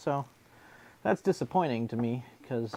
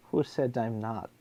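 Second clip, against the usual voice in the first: usual speaking voice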